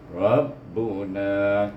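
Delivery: Rabbuna with long na; very slow Rabbuna, stretched na; over enunciating. Shown as a demonstration. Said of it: "na yang panjang".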